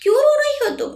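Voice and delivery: narrated angry demand, pitched sharply up. A narrator's reading. Arijit confronts crying Chanchal by the car, angry. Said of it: "गुस्से में".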